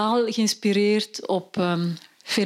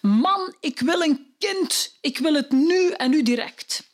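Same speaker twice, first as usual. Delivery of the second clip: high, loud demanding wife; the wife sitting up in bed demanding a child; 6 semitones higher, slower than usual. Acted. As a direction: shout it up at her husband. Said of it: "ze roept heel luid".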